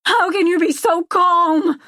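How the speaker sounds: high, slow, incredulous yell; distraught, high-pitched shout; shouted demand. A narrator's reading. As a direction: demand it at the top of your voice. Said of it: "I demand".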